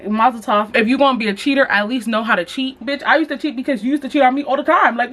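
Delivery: ordinary talk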